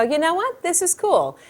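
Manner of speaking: high, light quoted voice; pleased discovery. Acted. Acts out woman liking the ball.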